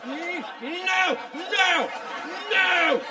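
high-pitched protest; high squeaky no. Real, not acted. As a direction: squeal the refusal. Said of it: "high protesting nos".

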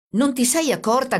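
officer shouting at the woman. A narrator's reading. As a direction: yell it at her. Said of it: "gridò il carabiniere".

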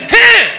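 high, loud crisp fighter's "Heh"; sharp punch grunt. Retold or imitated. Showing the perfect movie punch with a high loud grunt. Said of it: "well-calculated".

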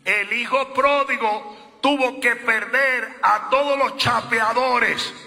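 loud, slow preacher's build; preaching. Real, not acted. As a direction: thunder it from the pulpit, slow and loud. Preaching at full force. (predicación a todo pulmón)

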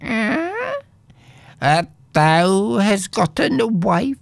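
a small goblin begging him to turn back; a hint of an eerie goblin, urgent and pleading.

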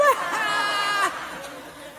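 Portuguese guy crowing with a high cackle; high cackling laugh. Acted. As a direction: laugh hard at the loser. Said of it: "the Portuguese guy's laughing".

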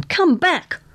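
high call to return; narrated hail; mild shout. A narrator's reading. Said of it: "shouted the man".

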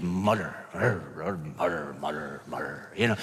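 low, soft mock muttering; muttering sound. Shown as a demonstration. Preacher demonstrating what muttering sounds like, low mumble. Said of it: "It sounds like what it is".

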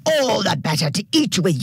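mild storybook yell in an altered voice; dingo's yell. A narrator's reading. Dingo drops the disguise to eat the girl, mild character yell. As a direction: burst out as the hungry dingo. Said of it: "yelled the dingo".

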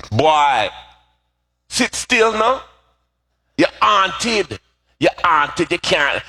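high-pitched Jamaican mother scolding; Jamaican accent. Retold or imitated. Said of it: "in her Jamaican accent".

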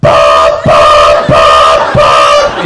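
low loud mock alarm beeps. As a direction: beep like an alarm clock in a dream. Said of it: "And she goes".

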